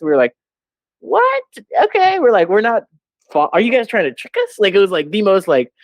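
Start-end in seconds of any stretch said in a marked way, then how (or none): 1.0-4.6 very high, stunned reaction; shocked squeak. Retold or imitated. Reacting to the staff's hint, a high shocked squeak. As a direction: squeak it out, totally thrown.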